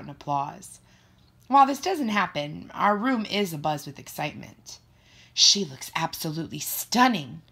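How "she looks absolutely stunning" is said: ordinary delivery, nothing marked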